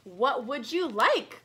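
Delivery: loud slow shop call. Acted. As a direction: call out to customers. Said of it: "called Camellia".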